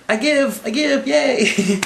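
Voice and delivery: high-pitched feeble giving up; hammed-up silly capitulation; mock surrender. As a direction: give in with a high cartoon voice. Voicing Loki surrendering.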